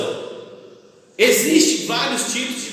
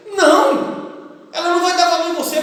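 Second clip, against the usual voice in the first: emphatic no; 9 semitones higher than usual. Real, not acted. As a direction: slam the answer home. Emphatic answer to own question.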